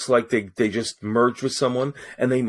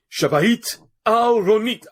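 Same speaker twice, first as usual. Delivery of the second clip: high, drawn-out announcer's introduction; ring announcer in Hebrew; 11 semitones higher, 4 dB louder, slower than usual. Shown as a demonstration. Voice actor showing his charity event intro, ring announcer. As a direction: announce the Hebrew name slowly, pitched high. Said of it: "I had to lead into that with".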